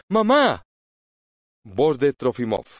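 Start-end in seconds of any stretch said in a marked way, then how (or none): 0.1-0.6 high, loud joyful call. A narrator's reading. Ania calling her mother from offstage, joyfully.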